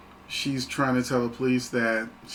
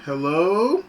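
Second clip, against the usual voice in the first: higher, louder "anyone there?" call with a rising end; horror-movie victim calling out; 6 semitones higher, 5 dB louder than usual. Retold or imitated. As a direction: imitate a clueless horror heroine calling into the dark. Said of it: "You know, she's saying".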